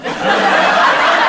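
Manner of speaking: crowd laughter. Real, not acted.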